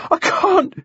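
put-on high winded boy; out of breath. A narrator's reading. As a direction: plead breathlessly, too winded to speak. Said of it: "He began to cough".

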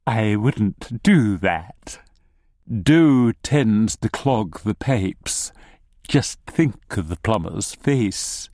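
slow, drawling gnome voice; wry gnome. Acted. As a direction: take your time, with a slight smile. Gnome answers, beginning to smile.

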